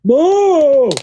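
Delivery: high, stretched-out mooing call; cartoon character impression. Calling to Eddie in a cartoon, high silly voice. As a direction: moo it high like a dopey cartoon. Imitating Ed, Edd n Eddy.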